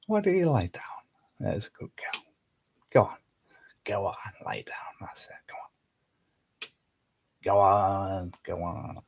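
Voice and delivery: soft unvoiced whisper to settle her. Real, not acted. Owner whispering to Bella so she lies down, hushed. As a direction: hush the cat down to lie.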